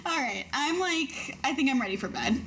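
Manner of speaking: ordinary delivery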